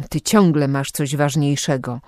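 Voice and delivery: ordinary delivery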